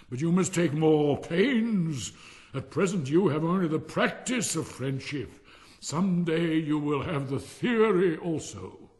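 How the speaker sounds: loud, high, slow lecturing; pompous miller. Acted. Miller instructs Hans on friendship, loud and pompous. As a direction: pronounce it grandly, stretching key words.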